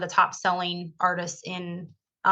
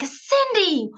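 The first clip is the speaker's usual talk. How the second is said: high, bright call of her name; old client spotting her at the gym, surprised call; surprised greeting; 12 semitones higher than usual. Retold or imitated. Old client greeting Cindy, high surprised call.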